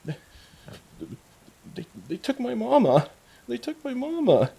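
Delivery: put-on very high, tearful little-girl voice; whimpering young girl. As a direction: whimper it in a high, small child's voice. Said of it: "just screaming and crying and just is whimpering".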